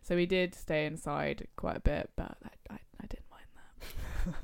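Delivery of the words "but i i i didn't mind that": hushed confession; whispering. Real, not acted. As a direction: whisper it like a secret. Admits enjoying staying in.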